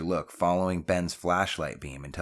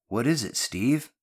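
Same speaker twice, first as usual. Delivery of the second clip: higher-pitched questioning call; 6 semitones higher, 3 dB louder than usual. A narrator's reading. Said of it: "Drew called down".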